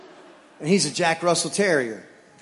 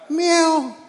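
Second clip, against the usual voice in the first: playful meow; 11 semitones higher, 6 dB louder than usual. Acted. Joking meow.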